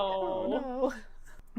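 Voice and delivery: small, soft dismayed aside; quietly watch it all sink. Acted. Player voicing a character as his plan sinks, quietly dismayed.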